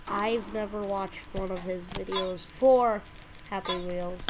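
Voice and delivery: usual speaking voice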